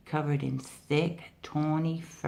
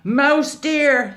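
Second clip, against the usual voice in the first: loud, high furious yell; angry tiger shout; 10 semitones higher, 10 dB louder than usual. Acted. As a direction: bellow the name in rage. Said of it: "he yelled".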